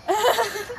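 laugh. Real, not acted. Laughing after confession.

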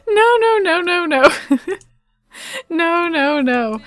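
half laughing, half crying in disbelief. Real, not acted. Horrified at the wedding mishap.

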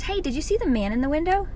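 put-on little girl voice. Nanny imitating the little girl in the bath. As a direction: ask it like a small curious kid. Four-year-old quoted.

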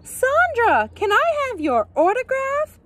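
very high-pitched starstruck fan; excited calling out. A narrator's reading. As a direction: call out eagerly to a famous star. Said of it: "Missus Martinez called out".

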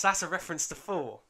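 usual speaking voice

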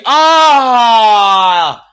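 a sudden loud cry of frustration; frustrated shout. Real, not acted. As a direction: yell out as the chance goes begging. Big chance missed, shouts.

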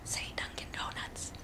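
barely audible whispered reminder. Shown as a demonstration. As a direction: whisper a prompt to a child in church. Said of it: "I might preemptively whisper to him".

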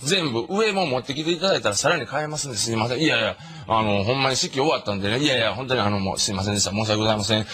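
put-on overly polite old man; apologetic uncle. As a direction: grovel politely on the phone. Humble apologetic uncle on phone.

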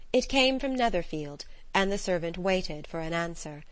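plain reading voice

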